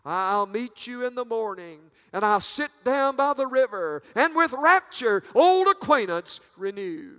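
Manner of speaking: from measured to ringing pulpit cry; rapturous preaching. Real, not acted. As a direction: recite the hymn with swelling fervor. Recites old song, building.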